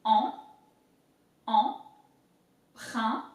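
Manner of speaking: "en, prin" as isolated French nasal sounds; teacher splitting a French word; syllable by syllable. Shown as a demonstration. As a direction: sound out "en" and "prin" with long gaps. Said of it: "Let's break it down".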